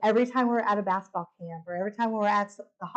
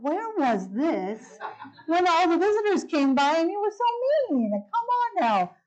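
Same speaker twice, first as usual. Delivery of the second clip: high, drawn-out mock indignation; indignant inner retort; 8 semitones higher, slower than usual. Silently challenging the desk lady's sudden niceness, mock-indignant. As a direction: pitch it up in incredulous, laughing protest. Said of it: "But I just thought it".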